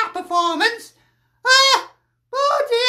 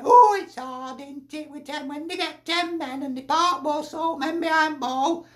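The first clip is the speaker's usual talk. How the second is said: mock Dyche excuse; 5 semitones lower, 5 dB quieter than usual. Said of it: "I suppose Dyche will say that".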